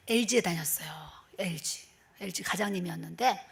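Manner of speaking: soft whispered confiding aside. Real, not acted. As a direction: lean in and whisper the detail.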